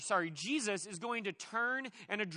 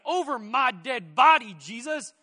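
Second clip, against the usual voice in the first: much louder, slow and set on every word; defiant refusal; 3 semitones higher, 11 dB louder than usual. Retold or imitated. Peter blocking Jesus from the cross, slow loud defiance. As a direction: plant your feet and refuse.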